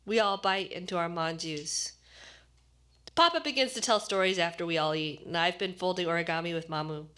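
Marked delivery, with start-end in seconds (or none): none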